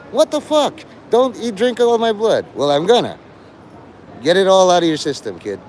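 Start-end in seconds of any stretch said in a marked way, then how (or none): 0.1-2.4 mock panicked kid. Kid attacked by vampire.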